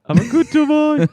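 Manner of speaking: very high, loud silly voice; hammed-up squeaky mockery; mocking dummy voice. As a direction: parrot it back in a piercing daft voice. Mocking friend's slip.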